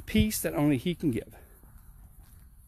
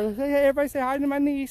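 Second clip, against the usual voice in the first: high, fast and bright with surprise; excited greeting; 8 semitones higher, much faster than usual. Real, not acted. Introducing his niece to viewers, excitedly. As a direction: quickly hail the camera audience.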